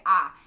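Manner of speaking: "ah" loud, open, no glide; mid-sentence "I" flattened to "ah"; Texan "ah". Shown as a demonstration. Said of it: "I say".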